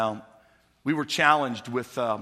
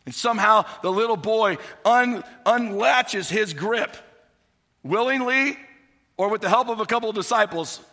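louder, higher preaching toward the climax; building sermon; 8 semitones higher, 6 dB louder than usual. Acted. Pastor building to the miracle for the church, fervent. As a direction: drive the story to its peak.

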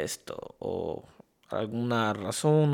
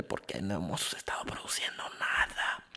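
whispered aside, much softer; mock confidential whisper; 7 semitones lower, 12 dB quieter than usual. Host admitting the show's idleness to listeners, mock whisper. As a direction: drop into a very soft whisper. Mock confession of producing nothing.

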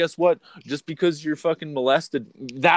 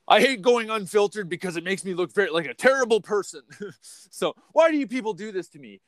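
high-pitched playful whine; hammed-up self-pity; mock lament; 7 semitones higher than usual. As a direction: plead in a raised voice as if put upon. Mock complaint at listeners.

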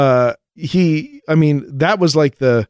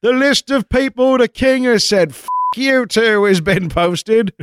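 town crier; 5 semitones higher than usual. Retold or imitated. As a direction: announce it like a herald. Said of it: "Hear ye, hear ye".

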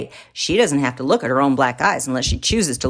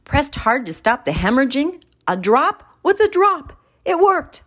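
much higher, rising alarmed questions; panicked disbelief; 10 semitones higher than usual. Real, not acted. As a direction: spiral up into panic. Husband panicking over wife's treatment.

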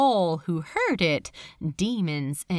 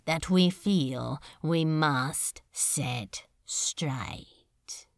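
slow whispered demon threat; menacing whisper; 5 semitones lower, 4 dB quieter, much slower than usual. Acted. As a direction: breathe the threat close to his ear. Beelzebub voiced in the story.

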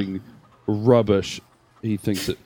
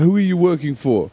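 higher put-on thug voice; put-on interrogator voice; 7 semitones higher than usual. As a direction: lean on the captive. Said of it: "What's wrong with your voice?".

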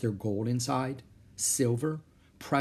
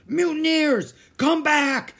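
very high, loud bellow; furious shout; 8 dB louder, slower than usual. A narrator's reading. Captain to his fleeing men, enraged shouting. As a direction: bellow after the deserters. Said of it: "shouted Captain Bones".

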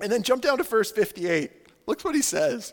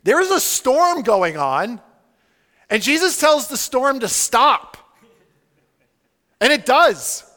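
loud, slow, weighty declaration; emphatic preaching; 4 semitones higher, 9 dB louder, slower than usual. Real, not acted. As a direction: drive each phrase home loudly. Preacher stresses the miracle.

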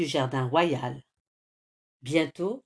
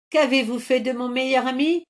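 higher, louder angry shout; 6 semitones higher, 6 dB louder, faster than usual. A narrator's reading. Hero demands his friend from the troll, shouting. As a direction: yell it at the troll. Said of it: "hurle Carmelito".

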